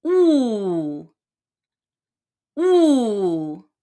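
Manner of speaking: 雾 in a falling fourth tone. Shown as a demonstration. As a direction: drop 雾 hard, fourth tone. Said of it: "the fourth tone".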